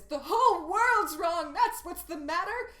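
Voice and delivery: big declaration. Shown as a demonstration. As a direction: belt it out as a grand feminist statement. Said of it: "this big declaration".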